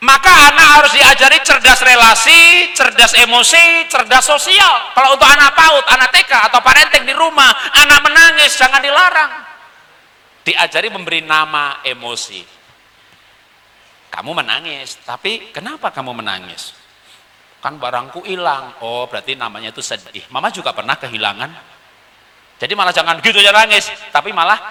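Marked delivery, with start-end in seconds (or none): 0.1-9.3 high, loud, driving sermon voice; rally the hall like a preacher; impassioned preaching. Acted. Speaker urging parents on national unity, preaching at full force. 14.1-21.7 gentle mother. Acted. Mother comforting crying child.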